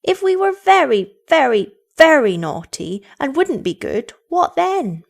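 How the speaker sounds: put-on high, loud childish challenge; insistent children. A narrator's reading. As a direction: press the point, leaning on each very. Children press mother with defiant question.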